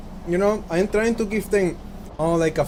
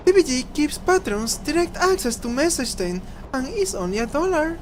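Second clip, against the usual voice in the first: raised character voice; 10 semitones higher than usual. Acted. Voicing a game character.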